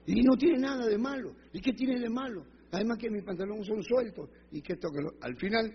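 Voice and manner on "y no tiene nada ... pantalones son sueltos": high-pitched, defensive retort; grumbling protest. Retold or imitated. Woman defends her trousers to her church sister, grumbling. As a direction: argue back with the pitch jumping up. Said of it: "Y ella renegaba".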